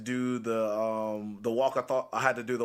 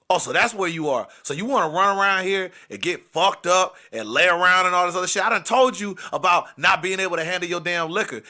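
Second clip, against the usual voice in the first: loud, raised-pitch scolding; jealous boyfriend tirade; 6 semitones higher, 6 dB louder than usual. Retold or imitated. Boyfriend scolding a smashed girl about her drinking, loud and angry. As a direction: lay into her with loud bravado. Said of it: "I was being so loud".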